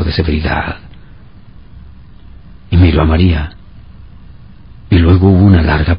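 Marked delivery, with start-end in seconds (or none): none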